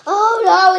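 sudden loud alarmed oh no; surprised oh no. Real, not acted. Kid sees slime stuck to table, alarmed. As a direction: yelp it as the slime sticks.

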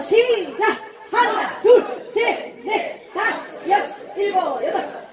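barking gym coach. Real, not acted. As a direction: shout the count to the class. Coach counting exercise reps.

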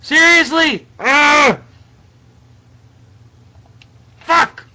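very high, loud yells; furious outburst. Real, not acted. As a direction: explode with gamer frustration. Repeated failure at the game.

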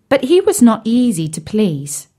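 usual speaking voice

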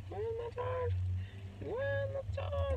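very high squeaky repeated question; high-pitched calling. Real, not acted. Playfully repeats hunt for charger.

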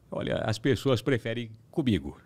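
lightly imitated TV boss, measured delivery; pause, then pronounce it like the boss; affectionate light imitation; imitating the boss. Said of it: "ele imita igualzinho".

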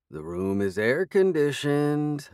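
slow, lilting sing-song tease. A narrator's reading. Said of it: "in a sing-song voice".

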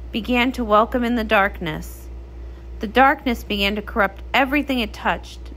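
lowering, measured fairy-tale telling; once-upon-a-time narrator voice; storybook narration. Acted. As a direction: tell it like a legend by firelight.

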